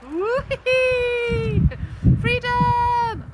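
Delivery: high, loud gleeful cries; playful excitement. Pretend. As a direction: cheer like the hat flew off. Hat taken off puppet.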